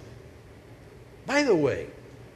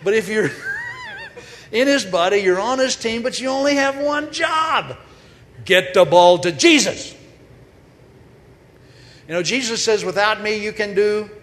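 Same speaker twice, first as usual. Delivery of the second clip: preaching at full force, much louder and higher; fiery preaching; 9 semitones higher, 9 dB louder than usual. Acted. Preacher rousing the congregation about God's one team, shouting. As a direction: drive it home to the congregation at full volume.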